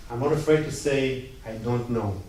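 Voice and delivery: ordinary delivery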